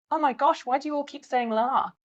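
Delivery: high, sneering whine; mocking expat. Expat mocking the locals' la, high and sneering. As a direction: lightly ham up a snooty expat.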